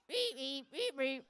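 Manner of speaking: short, repeated reedy frog peeps; frog croak imitation. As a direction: imitate a frog going reep. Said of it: "he's more like a".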